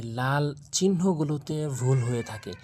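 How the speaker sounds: ordinary delivery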